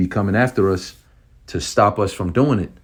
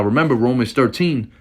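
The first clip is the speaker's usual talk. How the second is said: raised, heated sermon voice; fiery preaching; 3 semitones higher than usual. Real, not acted. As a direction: preach against them with zeal. Fiery preaching against the devils.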